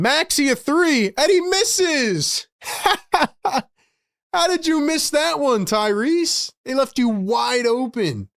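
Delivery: "and he misses" stretched way up, then a laugh; exasperated disbelief. Real, not acted. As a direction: squeal of disbelief at the wide-open miss. Maxey misses a wide-open three.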